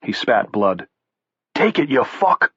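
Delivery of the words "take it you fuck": put-on yelled, higher and thick Boston accent. Beaten brawler yelling at the bald man, thick weepy Boston accent. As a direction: spit it out in a weepy Boston yell. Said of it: "he yelled in a thick".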